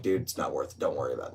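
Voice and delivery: low, soft, dismissive reassurance; understated talk-him-down voice; calming down. Retold or imitated. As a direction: defuse it quietly, dropping pitch and volume. Acts out calming the angry man.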